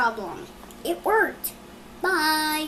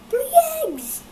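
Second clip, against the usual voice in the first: high, louder squeal of surprise; mock shock; 13 semitones higher, 6 dB louder than usual. Child retelling Elephant's shock at three eggs.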